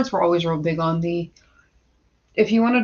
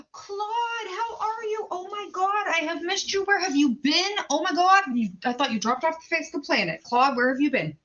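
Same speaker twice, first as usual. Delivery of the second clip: bubbly high welcome; excited greeting; 9 semitones higher, 3 dB quieter than usual. Real, not acted. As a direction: squeal the welcome like you missed them. Excitedly greeting a returning viewer.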